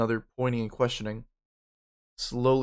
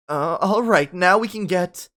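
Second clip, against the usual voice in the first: high, loud flustered start; a hint of a flustered headmistress; flustered principal; 6 semitones higher, 7 dB louder, slower than usual. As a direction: principal caught off guard before a crowd. Principal on stage before crowd.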